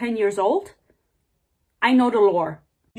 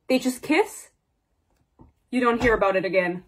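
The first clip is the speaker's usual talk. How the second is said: normal talking voice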